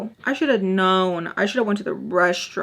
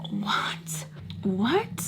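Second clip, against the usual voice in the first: whispered disbelief; breath-only, bewildered question; baffled whisper; 6 dB quieter than usual. Retold or imitated. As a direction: whisper it, totally confused. Confused by what he said.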